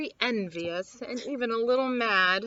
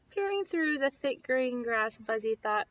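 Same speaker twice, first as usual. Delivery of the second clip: ordinary delivery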